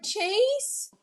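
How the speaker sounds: high questioning call of the name; high wary call into the room; calling out Chase. Retold or imitated. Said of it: "She's like".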